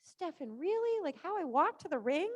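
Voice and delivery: incredulous squeak on really; mock surprised protest; mock disbelief. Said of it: "I'm like".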